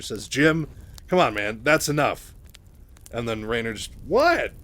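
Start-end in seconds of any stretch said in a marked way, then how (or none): 0.3-2.3 loud, raised-voice rebuke; Mike, stepping in. Retold or imitated. Mike tells Raynor to ease off Kerrigan, loudly exasperated. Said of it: "knows how much pressure Kerrigan is under". 4.1-4.5 high, loud protesting yelp; Raynor, mock innocent. Raynor protests that he's only having fun, high and indignant. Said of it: "he's just trying to have fun".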